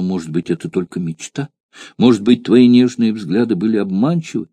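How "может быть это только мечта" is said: ordinary delivery, nothing marked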